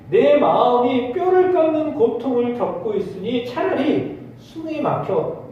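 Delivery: slow, high solemn reading of anguish; scripture reading. Acted. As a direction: draw out the verse slowly. Reads verse slowly.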